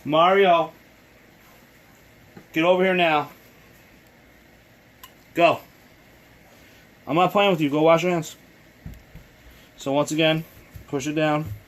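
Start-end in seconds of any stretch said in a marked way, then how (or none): 0.0-8.4 calling from far away. Real, not acted. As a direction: call across the house. Parent yelling to child elsewhere.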